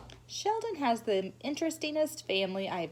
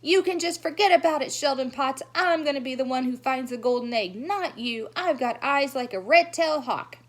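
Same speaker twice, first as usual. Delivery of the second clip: loud, high shout through cupped hands; 6 semitones higher, 7 dB louder than usual. Acted. May shouting after Sheldon that she'll find the egg. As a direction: yell it after him, defiant. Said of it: "she shouted after him".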